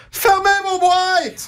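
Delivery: high, very slow mock call for silence, boîtes as "bwatt"; slow, high-pitched mock order to a chatty room; imitating Linda shouting at the crowd. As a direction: drag out Fermez vos boîtes like a hushed-up host. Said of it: "je vous imite dans un événement".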